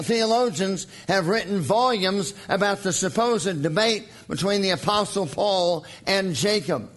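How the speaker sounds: ordinary talk, nothing marked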